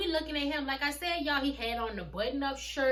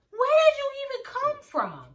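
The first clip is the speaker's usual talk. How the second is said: surprised shout; 14 semitones higher, 10 dB louder than usual. Retold or imitated. Retelling surprise attack, shouted.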